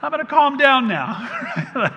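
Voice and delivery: put-on comic drawn-out concession to a toddler; mock surrender. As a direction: stretch it out like a defeated dad. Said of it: "I was like".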